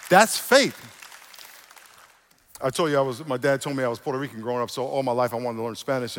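normal talking voice

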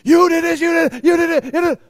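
high, loud accusing shout. Acted. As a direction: yell the blame at someone in an argument. Acted argument accusation.

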